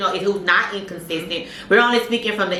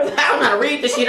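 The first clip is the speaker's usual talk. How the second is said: laughing panic; 6 semitones higher, faster than usual. Retold or imitated. Retold panic over location app.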